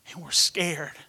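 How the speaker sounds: normal talking voice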